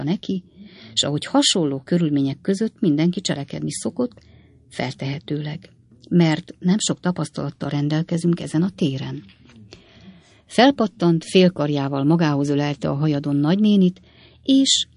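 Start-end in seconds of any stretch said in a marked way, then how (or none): none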